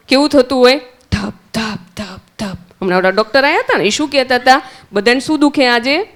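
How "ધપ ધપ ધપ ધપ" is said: soft, fading thump-thump of a heartbeat; heartbeat imitation. Shown as a demonstration. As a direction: tap out a heartbeat for the children, dropping away. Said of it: "એ કેવું થાય?". (ધીમા અવાજે ધબકારાની નકલ)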